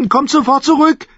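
put-on high, loud little girl's call; high-pitched princess calling out; calling after someone. A narrator's reading. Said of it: "rief sie hinter ihm her".

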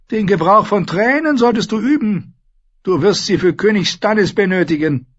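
high, loud, imperious queen voice; Cersei, haughty queen. Acted. Queen lecturing Sansa at the feast, haughty and loud. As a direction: play the proud queen lecturing the girl.

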